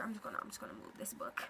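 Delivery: quick whispered aside to herself. Real, not acted. Muttering to herself as she tidies a book, whispering. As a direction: breathe it fast, just under the breath.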